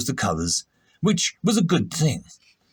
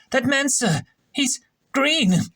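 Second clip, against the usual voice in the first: put-on much higher, eager young voice; flustered junior officer; 11 semitones higher than usual. Shrub blurting to the captain, high and eager. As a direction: blurt it out like a nervous subordinate. Shrub reports the green stranger.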